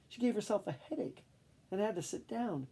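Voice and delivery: plain reading voice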